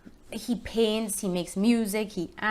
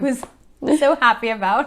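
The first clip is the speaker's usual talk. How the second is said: laughing through the words, a bit higher and louder; happy laugh; 4 semitones higher, 9 dB louder than usual. Real, not acted. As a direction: let a delighted laugh spill into it. Thrilled about Spanish dub.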